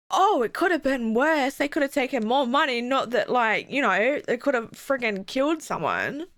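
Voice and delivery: usual speaking voice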